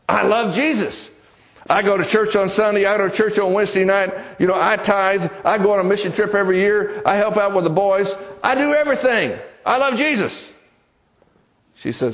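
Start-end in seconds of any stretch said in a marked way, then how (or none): 0.1-10.5 rising, pleading insistence climbing higher; defensive protest. Retold or imitated. Bob protesting to his sister that he loves Jesus, climbing higher. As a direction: protest it, higher and more wound up with each line.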